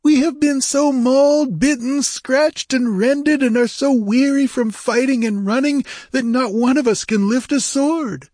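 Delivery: very high, pitiful complaint; whining pirate. A narrator's reading. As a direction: whine about your suffering. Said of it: "whined a red-sashed Zamorian".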